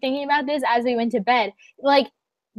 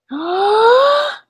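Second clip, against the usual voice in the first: long, loud acted gasp; big, high indignant intake of breath; shocked gasp; 9 semitones higher, 5 dB louder than usual. Acts out shock at slip.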